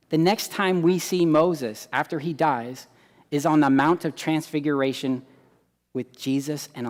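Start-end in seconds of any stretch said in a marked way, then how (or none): none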